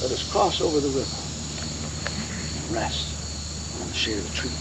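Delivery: low, hushed last words; very quiet and calm. Retold or imitated. Dying Jackson says his last words, very quietly and calmly. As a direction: speak very softly and low, pausing between phrases. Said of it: "Very quietly, very calmly, he says".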